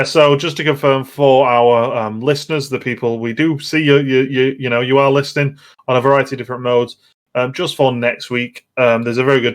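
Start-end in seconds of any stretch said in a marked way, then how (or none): none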